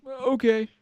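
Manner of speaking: mock him with a squeaky eager okay; a lightly hammed girlish voice for Caden; mocking girly okay. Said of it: "She was like".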